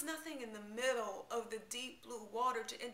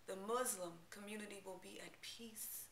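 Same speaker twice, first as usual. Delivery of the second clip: a hushed whisper to close the line; soft whispered line; 7 dB quieter than usual. Acted. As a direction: breathe it out as a prayer for peace. Poem's closing line on peace.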